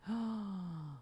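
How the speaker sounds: a sudden soft intake of breath; quick breathy gasp; sharp gasp. Real, not acted. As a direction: gasp in surprise at the price. Spots a coveted amp.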